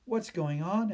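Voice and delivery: put-on high, loud boy's question; anxious question. A narrator's reading. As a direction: ask the crowd urgently. Boy questions angry crowd.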